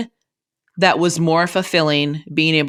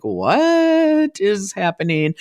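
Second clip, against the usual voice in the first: slow, higher, baffled question; puzzled parents; 4 semitones higher, much slower than usual. Retold or imitated. Parents puzzled on the drive, slow baffled asking. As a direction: stretch it out like confused parents. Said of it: "And they're like".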